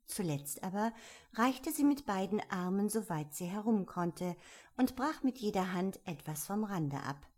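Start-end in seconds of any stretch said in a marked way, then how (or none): none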